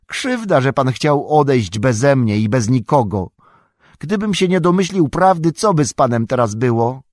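high-pitched, aggrieved protest; hurt reproach. Acted. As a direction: protest as if wronged by your master. Sam hurt, reproaching Frodo for leaving.

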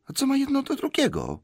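very high, squeaky retort; high-pitched baffled question; bewildered hobbit. A narrator's reading. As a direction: protest in a high little voice.

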